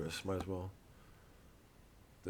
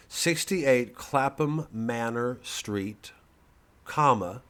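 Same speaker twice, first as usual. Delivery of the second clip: very slow, loud dictation; dictating slowly; 3 semitones higher, 6 dB louder, much slower than usual. Shown as a demonstration. Dictating the address to viewers, slow and loud. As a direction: spell it out for them to write down. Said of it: "You writing this down?".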